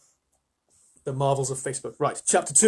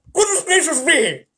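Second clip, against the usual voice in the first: high, loud startled cry; a flustered fawn yelping in surprise; startled exclamation; 12 semitones higher, 9 dB louder than usual. Acted. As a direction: jump with surprise as you blurt it. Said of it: "exclaimed the fawn".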